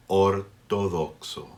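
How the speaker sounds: slow, pure O sounds in Ortodoxo; slow exaggerated speech modeling. Shown as a demonstration. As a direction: draw out Ortodoxo, every O the same. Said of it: "Each O only has one sound".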